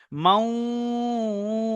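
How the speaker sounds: মাউইদা very slow, long nasal hum into the w; ghunna demo. Shown as a demonstration. Teacher giving the waw example, drawn-out nasal recitation. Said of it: "একালিক পরিমাণ গণনা করে পড়তে হবে".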